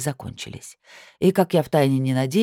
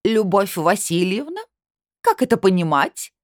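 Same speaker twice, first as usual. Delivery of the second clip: raised, high-pitched indignant demand; outraged cry; 6 semitones higher, 4 dB louder than usual. A narrator's reading. Delegate bursting in to confront the narrator, outraged cry. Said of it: "она завопила".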